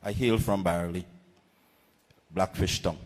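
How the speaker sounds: usual speaking voice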